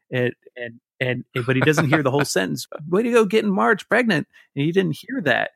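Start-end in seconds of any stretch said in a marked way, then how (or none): 2.9-4.3 much higher cartoon cop calling out; Wiggum voice. Man retelling Simpsons, cop cheering Homer in high voice. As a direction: call out like a goofy cartoon cop.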